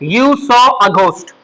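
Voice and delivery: each English word stretched out; slow teacher's model sentence; slow dictation. Shown as a demonstration. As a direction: give the translation very slowly for note-taking. Teacher dictates English translation slowly.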